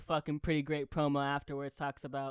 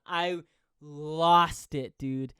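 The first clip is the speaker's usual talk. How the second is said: ordinary delivery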